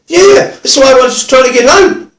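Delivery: high, loud mock outburst; exasperated offender. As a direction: imitate a worked-up bloke protesting loudly. Imitating exasperated offender explaining himself.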